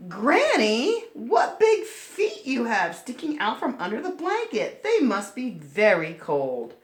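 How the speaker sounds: put-on high, sweet concerned child voice; a caring girl fussing; little girl voice. Red tending Granny's feet, high sweet child voice.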